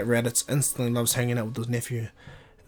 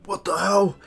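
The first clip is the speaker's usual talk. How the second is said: higher, louder retold yell of shock; shocked angry shout; 7 semitones higher, 6 dB louder, slower than usual. Raditz, just gasping from the vision, yells in shock. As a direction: bark it out after catching your breath.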